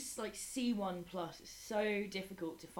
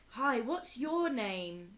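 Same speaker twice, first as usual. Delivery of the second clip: very slow, higher and louder greeting; slow teacher voice; 6 semitones higher, 6 dB louder, much slower than usual. Retold or imitated. Teacher greeting a little pupil, slow and high. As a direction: spell it out slowly for a small child.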